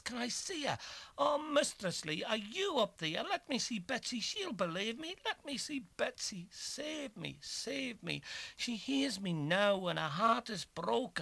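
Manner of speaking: high anguished pleading; frantic begging. A narrator's reading. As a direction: beg frantically to see her. Begging to see Betsy.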